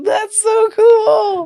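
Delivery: high, slow, laughing delight; thrilled and laughing. Retold or imitated. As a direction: gush with childlike wonder. Reacting in the IMAX flight.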